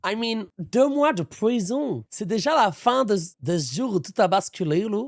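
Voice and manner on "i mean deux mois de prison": slow, mock English accent on "deux mois de prison". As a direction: drawl it like an Englishman, slowly. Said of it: "Ça part en accent". (accent anglais moqueur et lent)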